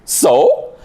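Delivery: P=A; high dismissive So. Retold or imitated. Quoting mother's reply, higher.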